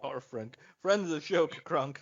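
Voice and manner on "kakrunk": ordinary talk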